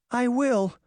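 small high whimper; high, meek little promise. A narrator's reading. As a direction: whimper it like a scared little sister. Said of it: "Daphne whimpered".

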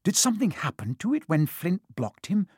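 ordinary delivery, nothing marked

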